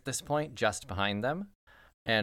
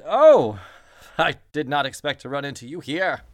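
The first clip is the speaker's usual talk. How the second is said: startled prince; 3 semitones higher, 11 dB louder than usual. Acted.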